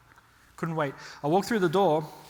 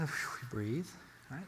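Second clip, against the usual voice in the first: calming self-talk; 4 semitones lower, 11 dB quieter, much slower than usual. Retold or imitated. Calming himself after the shooting.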